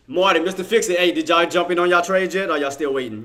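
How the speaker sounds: loud, high-pitched hail to the team; calling out. Real, not acted. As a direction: holler across the room to your crew. Calls out to named chat members.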